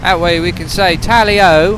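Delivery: high, loud hammed-up British accent; a playful over-the-top British accent; mock British accent. Hammed-up British accent while flying with friends. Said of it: "How is my British accent".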